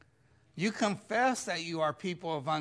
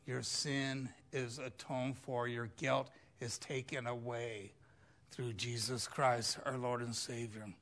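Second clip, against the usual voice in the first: soft, lower, unhurried pastoral voice; gentle reassurance; 4 semitones lower, 3 dB quieter than usual. Acted. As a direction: speak forgiveness softly to each listener. Gentle assurance to congregation.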